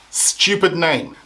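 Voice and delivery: ordinary talk, nothing marked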